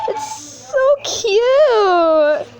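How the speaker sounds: very slow, high, whiny squeal of delight; squealing cute. Real, not acted. Vlogger squeals at the plush bird, high drawn-out whine. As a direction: stretch it out like it hurts how cute it is.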